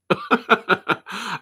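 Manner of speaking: high burst of laughter; real laugh. Real, not acted. Host admitting they can't watch the show, laughing. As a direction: laugh out in quick high pulses.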